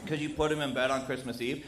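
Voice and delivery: usual speaking voice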